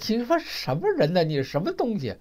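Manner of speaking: sharp high-pitched indignant retort; retold angry outburst. Friend cursing out the banker on the phone, high and indignant. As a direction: bark it back, offended and high. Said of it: "我这朋友破口就大骂".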